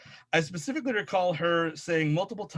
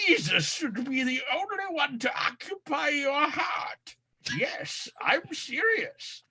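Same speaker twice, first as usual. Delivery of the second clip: nerd voice; 8 semitones higher than usual. Acted. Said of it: "that was my nerd voice".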